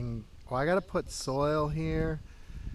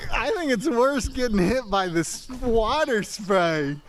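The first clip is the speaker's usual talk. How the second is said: loud, high yelling; shouting in cold water; 7 semitones higher, 8 dB louder than usual. Real, not acted. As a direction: yell over the cold spray.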